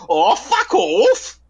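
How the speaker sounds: high, very slow drawn-out groan of annoyance; stamping bloke's retort. Retold or imitated. Bloke stamping about on the grass, long high fed-up groan. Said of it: "the way that they said it".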